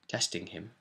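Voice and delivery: ordinary delivery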